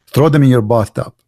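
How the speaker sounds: ordinary talk, nothing marked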